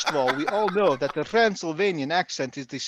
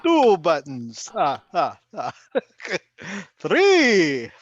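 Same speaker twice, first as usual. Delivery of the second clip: put-on Transylvanian accent; 4 dB louder, slower than usual. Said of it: "the Transylvanian accent is the sexiest accent".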